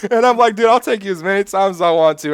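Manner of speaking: high, loud, talking through real laughter; laughing. Real, not acted. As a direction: still cracking up while telling it. Laughing while retelling his story.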